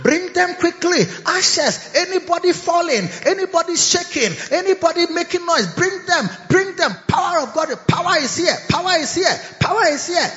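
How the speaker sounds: high, urgent ushering calls; crusade preacher calling. Retold or imitated. Preacher voicing a bishop's crusade calls: high urgent chanting. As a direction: hurry the ushers, the power is here.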